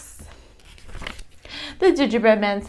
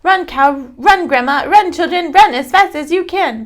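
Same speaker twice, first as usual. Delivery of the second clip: higher and louder teasing shout; gingerbread man shouting; 9 semitones higher, 7 dB louder than usual. A narrator's reading. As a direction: taunt the cow to run after him. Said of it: "laughs and shouts".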